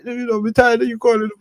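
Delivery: high-pitched mock Jamaican; put-on Jamaican voice, high; mimicked bad Jamaican accent. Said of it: "It's Eddie Griffin. He always does the same".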